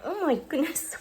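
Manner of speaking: ordinary talk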